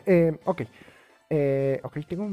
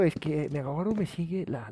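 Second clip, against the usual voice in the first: normal talking voice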